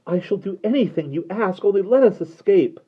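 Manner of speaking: high, loud beseeching plea; desperate girl begging. Acted. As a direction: beg the creature not to leave. Derna begs.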